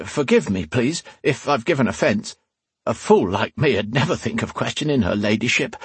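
high, eager, grovelling commoner; fawning peasant. Acted. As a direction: play the humble fool. Rian plays humble peasant.

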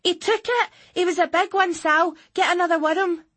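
put-on high, loud excited child voice; bursting with excitement; thrilled girl. A narrator's reading. Girl thrilled by a big fish.

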